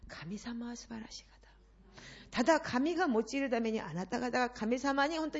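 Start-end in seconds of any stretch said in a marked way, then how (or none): 0.1-1.3 1 whisper. Real, not acted. Intimate aside to congregation.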